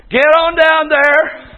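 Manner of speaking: usual speaking voice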